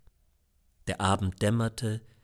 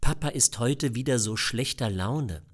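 usual speaking voice

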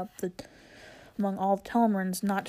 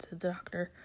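ordinary delivery

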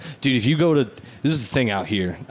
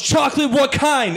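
donut lady voice. Retold or imitated. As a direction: bark the order like a brusque shopkeeper. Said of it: "She goes".

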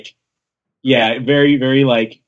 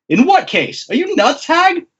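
high-pitched, disbelieving retort; rising, hammed-up incredulity; incredulous insult; 12 semitones higher than usual. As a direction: fling the insult upward in disbelief. Acts Queen insulting Big Mom.

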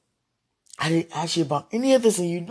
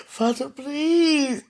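slow, crying plea; drawn-out wail; 7 semitones higher, much slower than usual. Real, not acted. Desperate plea.

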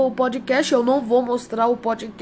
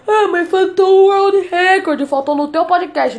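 louder, higher whiny listener complaint; mock complaining listener; 6 semitones higher, 6 dB louder than usual. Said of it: "Aí se você falar".

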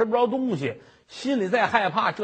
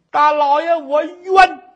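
high, loud wail of injustice; anguished cry; 8 semitones higher, 10 dB louder than usual. Acted. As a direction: cry out in pain and despair. Woman cries out under torture.

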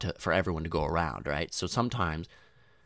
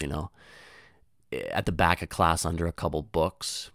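usual speaking voice

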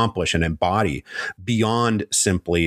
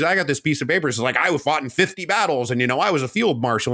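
a lightly voiced, higher-pitched boastful officer showing off his papers; mock braggart officer; 7 semitones higher than usual. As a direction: puff up like a fake war hero waving a letter. Bragging officer quoted mockingly.

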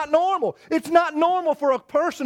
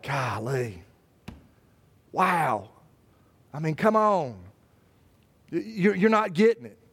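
low, soft disappointed sigh; a weary head-shaking "golly"; Jesus sighing; 8 semitones lower, 4 dB quieter than usual. Acted. Jesus looking down at families, quietly disappointed. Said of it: "He's just like".

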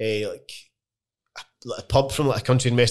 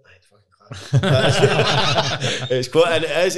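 laughing; 4 semitones higher, 5 dB louder, much slower than usual. Real, not acted. Laughing agreement about the track.

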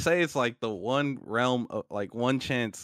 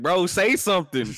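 urgent self-nudge; 7 semitones higher, 6 dB louder than usual. Pretend. Urging his frozen self.

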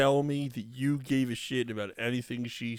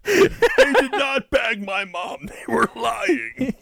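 slow, higher, laughing denial; mock Greta statement; 8 semitones higher, 5 dB louder, slower than usual. Voicing Greta's official statement, slow and laughing. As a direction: deny it with mock indignation.

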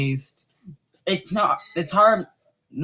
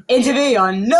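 dramatic announcement; 7 semitones higher, 4 dB louder than usual. Acted. Announcing the song title.

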